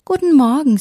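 high, loud chirped greeting; chirping bird. A narrator's reading. Blue tits greeting the elf back, chirping. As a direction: chirp it back, pitched well up. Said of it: "zwitscherten die Blaumeisen".

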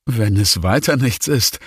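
plain reading voice

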